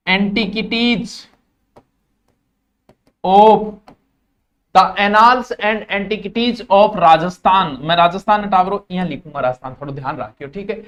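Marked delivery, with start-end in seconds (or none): 0.1-7.8 each word spaced out slowly for notes; slow dictation. Shown as a demonstration. Teacher dictating to students, slow and deliberate. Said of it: "पहली है annals".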